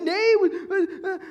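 put-on a little brother blaming his older brother, crying out; crybaby wail. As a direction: wail the name like a scared little kid. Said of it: "I said".

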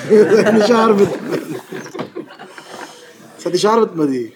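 high, loud real laughter through the words; laughing. Real, not acted. Teller cracking up at own story, laughing. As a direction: crack up while trying to talk.

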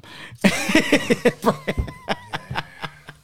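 a helpless high laugh; high burst of laughter. Real, not acted. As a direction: let the laugh break up high. Big laugh after story.

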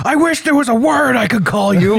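high mock scream of outrage; Michael Richards. Retelling a TV row before a crowd, mock screaming. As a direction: imitate a furious man screaming, high-pitched. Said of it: "Michael Richards is screaming at him".

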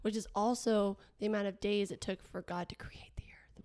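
unvoiced conspiratorial whisper. Real, not acted. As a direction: whisper it like a secret. Secret aside about extra peeps.

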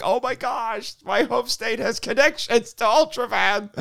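high, slow, stretched-out amazement; freaking out. Retold or imitated. Fan amazed at the home state's link, freaking out. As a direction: gush high, drawing out each word. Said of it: "I was just freaking out".

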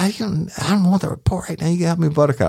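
high-pitched imitation of an angry, cursing woman; Miss Mary imitated. Deputy retelling the woman yelling back at him, high mock-angry voice. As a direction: squeak her up high, all attitude.

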